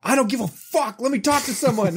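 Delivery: high, exasperated mock outburst; furious pleading, pitch way up but not full volume; retold yelling. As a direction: re-enact demanding a human on the phone. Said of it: "just me yelling".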